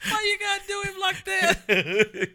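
high, loud laughing whine; laughing protest. Real, not acted. Friend protests the teasing, laughing high and loud. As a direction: laugh through the question.

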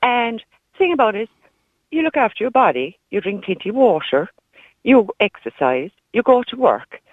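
normal talking voice

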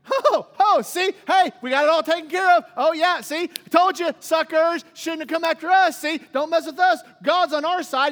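loud, high-pitched gloating taunts; trash talking. Acted. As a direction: crow over the beaten enemy, hammed-up. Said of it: "all the trash talking going on".

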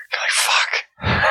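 whispered exasperated aside; whispered curse. Real, not acted. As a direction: breathe it out like a muttered curse. Exasperated aside about roosters.

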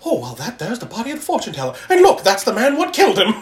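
mock old-time street gossip; nosy onlooker. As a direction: gawk and gossip like a nosy bystander. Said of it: "the old looky-loo standing across the street".

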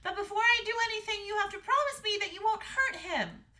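high, loud pleading princess; crying out. A narrator's reading. Daughter begging the parents to spare the dragon, crying out. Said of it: "she cried out".

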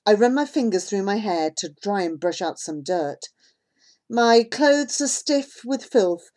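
ordinary delivery, nothing marked

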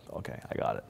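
breathy near-whisper, almost voiceless; hushed resignation. Retold or imitated. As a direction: murmur it to yourself, resigned to dying. Inner thought facing death.

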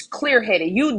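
ordinary talk, nothing marked

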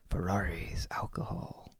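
hushed and mock-alluring; a tempting whispered list; teasing whisper. Listing pop culture's lures.

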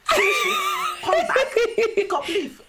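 high laughing squeal; hammed-up excited mum; imitating mum. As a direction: shriek it like mum. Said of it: "she was like".

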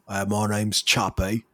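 slow, British-sounding Chappie; British accent. Retold or imitated. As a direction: introduce yourself slowly in a British accent. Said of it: "They're all British".

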